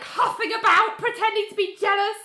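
high and loud retelling; getting worked up telling it; excited recap of the fight. Real, not acted.

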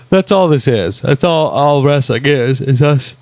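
talking through a yawn; words stretched by a yawn. Real, not acted. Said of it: "Sorry, I'm yawning, people".

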